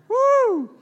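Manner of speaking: loud, high-pitched "woo"; high whoop. Real, not acted. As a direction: let out a sudden high whoop. Reacts to having a teenager.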